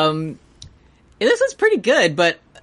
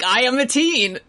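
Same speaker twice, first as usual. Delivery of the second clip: mock teen angst; 7 semitones higher than usual. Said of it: "I'm like".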